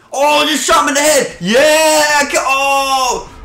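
high, loud yell at a kill; excited gamer whoop; triumphant shout. Real, not acted. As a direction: burst out high and thrilled. Shoots someone in game.

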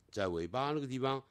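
usual speaking voice

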